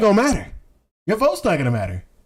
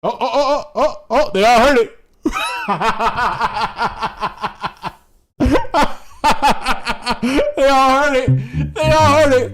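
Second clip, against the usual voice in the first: louder, delighted repeat, half laughing; gleeful; 3 semitones higher, 3 dB louder than usual. Real, not acted. Gloating to chat that everyone heard it, loud and gleeful. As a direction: crow over it with glee.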